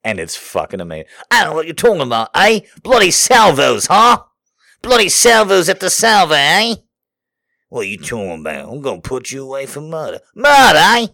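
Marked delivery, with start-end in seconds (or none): 1.3-6.8 loud, fast, high mock Aussie cop; over-the-top Australian accent. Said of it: "the Australian accents are hilarious". 7.7-10.2 fast, lightly voiced Aussie accent; a hint of an Australian accent. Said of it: "the Australian accents are hilarious". 10.3-11.1 loud, high mock Aussie exclamation; shouted mock Australian accent. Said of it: "the Australian accents are hilarious".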